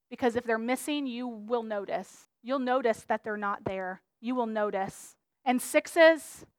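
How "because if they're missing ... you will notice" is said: soft earnest. Real, not acted. Quiet earnest appeal.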